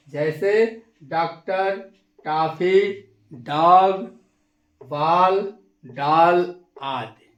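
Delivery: डॉक्टर, टॉफी, डॉग said with the vowel between aa and o; बॉल and डॉल drawn out with the open English o; English aw sound again. Shown as a demonstration. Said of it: "एक बार और".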